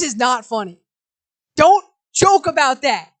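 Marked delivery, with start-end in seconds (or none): 1.6-3.1 annoyed. Real, not acted. Annoyed at chat.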